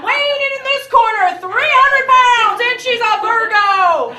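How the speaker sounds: loud, high, slow fight-night intro; hammed-up ring announcer. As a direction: boom it like a boxing announcer.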